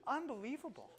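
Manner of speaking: high-pitched acted reaction; mock disbelief. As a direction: lightly imitate someone marvelling at what they see. Mimics reaction to bodies on beach.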